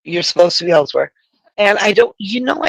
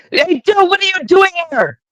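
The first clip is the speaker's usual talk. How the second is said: yelling; 12 semitones higher than usual. Real, not acted. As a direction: yell it out at Joe. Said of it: "I'm yelling".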